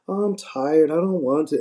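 a hint of a tired wife brushing him off; mock tired wife. Husband imitates wife's refusal.

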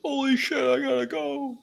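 very high frantic squeal; hammed-up alarm, rushing off; panicked imitation. Liu Kang hearing his friend's cry, very high panicked squeal. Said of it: "Liu Kang's like".

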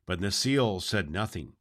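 plain reading voice

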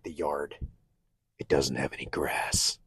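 hushed, low, exaggerated grievance; mock-upset whisper. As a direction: whisper it like a petty, joking complaint. Acted sulky reply to his wife.

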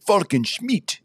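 high-pitched mock-Transylvanian vampire name; Transylvanian accent. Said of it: "in, like, a Transylvanian accent".

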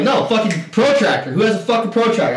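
high, insistent demand; retold exasperation. Player demands a protractor in the bar, exasperated. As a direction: call round the room, worked up.

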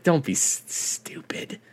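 voiceless whispered aside. Real, not acted. Chatting to cohost about the breakup, whispered aside. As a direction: breathe it as a hushed aside.

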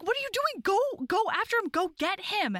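high-pitched acted exasperated command; urgent urging. Fallen heroine sending him after the thief, high urgent pleading. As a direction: push him onward in a high, frantic voice.

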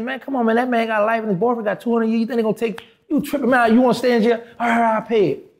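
high, incredulous pushback; exasperated scoff. Retold or imitated. To the buddy offering fifteen hundred, high exasperated scoffing. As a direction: brush off a cheap offer.

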